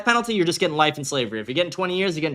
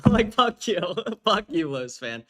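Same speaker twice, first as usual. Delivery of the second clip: laughing insult; 2 semitones higher, slower than usual. Real, not acted.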